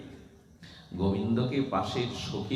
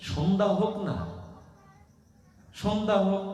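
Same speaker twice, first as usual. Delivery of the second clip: coaxing mother; 11 semitones higher than usual. Acted. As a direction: soothe the child, stretching Sandhya. Mother putting off hungry child. (উঁচু সুরে মায়ের ভোলানো)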